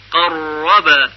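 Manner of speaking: قرب very slow, the doubled r trilled long and hard; قرب with a buzzing, drawn-out trill; overrolled r. Shown as a demonstration. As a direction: drag out the r of قرب in a heavy roll. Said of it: "نباید به این صورت بشه".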